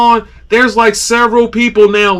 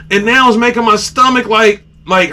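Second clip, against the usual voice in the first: usual speaking voice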